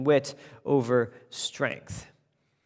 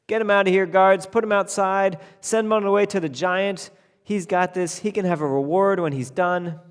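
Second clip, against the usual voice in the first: loud, higher orders to the guards; commanding king; 6 semitones higher, 6 dB louder than usual. Acted. King ordering guards to throw the tailor out, commanding. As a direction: bark it across the hall.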